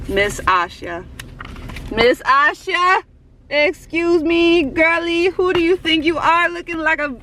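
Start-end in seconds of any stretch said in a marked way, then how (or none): 1.9-7.2 high, slow playful indignation; hammed-up fangirl outrage; mock scolding. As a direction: pretend to tell off your gorgeous idol. Mock scolding of beautiful idol.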